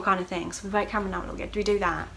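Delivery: normal talking voice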